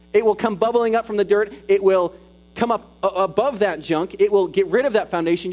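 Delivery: loud, high, driving sermon build; full-force preacher; preaching at full force. Acted. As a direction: preach it out with rising fire. Preaching the spring metaphor.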